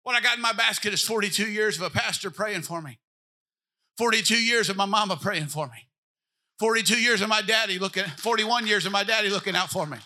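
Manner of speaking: loud, driving preacher cadence; full-force preaching. Acted. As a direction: preach it hard to the congregation.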